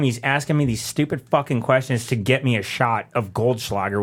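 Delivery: ordinary delivery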